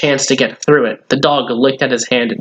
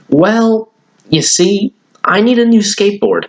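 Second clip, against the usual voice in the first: put-on eager boy; 7 semitones higher than usual. Billy telling Granddad he needs a new board, eager. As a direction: burst out eager, pitched up.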